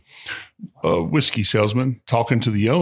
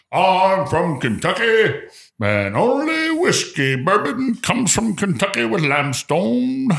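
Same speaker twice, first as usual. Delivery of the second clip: much higher, slow mock purist; mocking Kentucky snob; 9 semitones higher, slower than usual. Host mocking Kentucky bourbon purists in a high, slow voice. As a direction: lampoon the limestone-only crowd.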